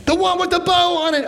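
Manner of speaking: put-on high, whiny child wailing for a dress; mock tantrum, high and whiny; screaming little girl. Child's demand, retold.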